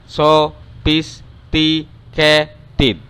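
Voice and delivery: slow finger-counting demo; counted out by syllable. Shown as a demonstration. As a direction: tap out every syllable as you say it. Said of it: "dia keluar semua lima jari".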